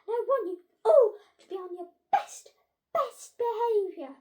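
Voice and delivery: high, loud, emphatic orders; impatient head teacher. A narrator's reading. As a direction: lay down the law, leaning on each key word. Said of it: "losing patience".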